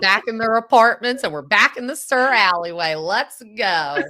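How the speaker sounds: high and loud, hyped to be back; excited cheer. Retold or imitated. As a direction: cheer it like a reunion party. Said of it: "I was like".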